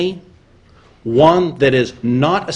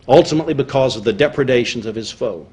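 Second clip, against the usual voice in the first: ordinary talk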